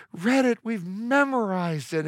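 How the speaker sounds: tired parents snapping back at smug friends; exasperated retort. Retold or imitated. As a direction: roll your eyes through it.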